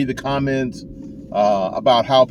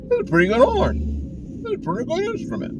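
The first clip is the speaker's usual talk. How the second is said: imitating his teacher. Teacher shrugging off a student horn, high mock voice. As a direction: play the teacher, lightly understating it. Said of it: "he puts the horn down and goes".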